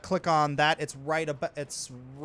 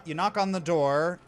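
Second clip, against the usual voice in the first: normal talking voice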